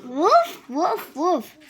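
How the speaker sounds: barking like a puppy; dog barking. Pretend. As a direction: woof it out like a dog. Child imitates dog in picture book.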